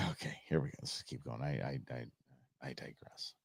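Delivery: barely voiced under-the-breath mumble; muttered aside. Real, not acted. Vlogger mutters an apology to viewers, whispered. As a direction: mutter it to yourself, almost whispering.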